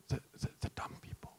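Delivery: barely audible whisper; under the breath. Pretend. Preacher slipping a forbidden remark to the congregation, whispered. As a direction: slip it out in a whisper. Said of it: "I didn't say that loud".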